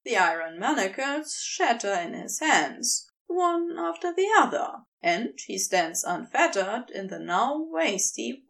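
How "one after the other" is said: usual speaking voice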